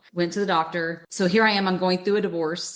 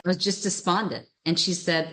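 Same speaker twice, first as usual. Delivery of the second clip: ordinary talk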